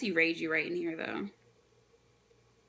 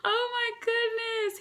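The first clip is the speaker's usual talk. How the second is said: much higher, slow, near-crying whine; overwhelmed; 14 semitones higher, slower than usual. Real, not acted. Reader overwhelmed by the panel, slow high whine. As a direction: wail it out, overcome.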